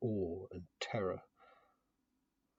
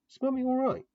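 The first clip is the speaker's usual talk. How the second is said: put-on high, timid little girl; small girl voice; 8 dB louder than usual. A narrator's reading. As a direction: ask like a worried small child. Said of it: "she asked".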